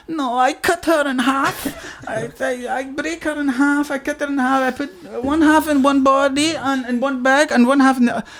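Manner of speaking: matter-of-fact Swedish cellmate; mock Swedish accent. Cellmate describes cutting body in mock Swedish accent. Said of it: "Swedish accent".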